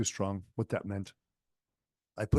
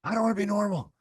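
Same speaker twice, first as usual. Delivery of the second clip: much higher, louder panicked wail; hammed-up panicked cry, voice pitched way up; freaked-out protest; 9 semitones higher, 3 dB louder than usual. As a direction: imitate him losing it in disbelief. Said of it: "And he freaked out again".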